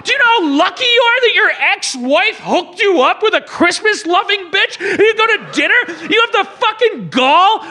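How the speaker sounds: high-pitched, indignant tirade; outraged rant. Acted. Scolding Santa for being picky about his date, outraged. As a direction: berate him in escalating disbelief. Berating the film's hero.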